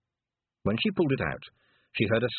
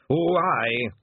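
louder Scottish reply; Scottish piper; 4 semitones higher, 5 dB louder than usual. Acted. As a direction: answer as an old Scottish piper.

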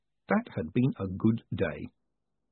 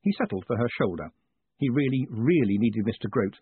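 ordinary delivery

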